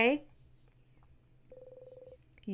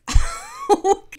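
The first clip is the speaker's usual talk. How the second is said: startled laugh; 10 dB louder than usual. Real, not acted. Startled laugh after shock.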